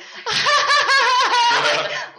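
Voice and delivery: high, loud burst of laughter; a loud laugh on entering a group; big laugh. Real, not acted. Laughing her way into the circle.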